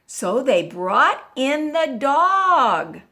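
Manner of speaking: ordinary delivery, nothing marked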